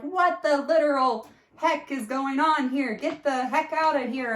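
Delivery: high, loud furious yell at the intruder. Retold or imitated. Retelling how she yelled at the naked man in her shower, high loud fury. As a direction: yell him out of the shower in outrage. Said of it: "cursing and screaming and yelling".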